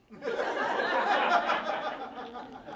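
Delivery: a real breathy laugh at the punchline; high laugh. Real, not acted. Speaker laughing at the priest's answer to the girls, high laugh. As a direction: laugh freely at the story.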